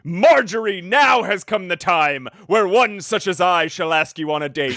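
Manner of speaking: hammed-up alien courting his wife; villainous alien voice.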